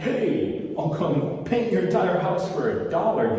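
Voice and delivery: high, loud cheesy sales pitch; hammed-up bargain contractor; mock salesman shouting a cheap deal. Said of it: "You don't wanna compete on price".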